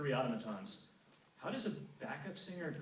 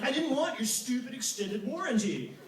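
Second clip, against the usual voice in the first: indignant retort; 10 semitones higher, 6 dB louder than usual. Retold or imitated. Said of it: "And I'm like".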